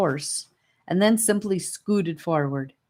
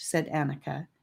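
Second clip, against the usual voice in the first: ordinary delivery, nothing marked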